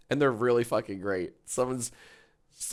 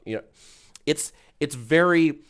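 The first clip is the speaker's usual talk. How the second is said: ordinary delivery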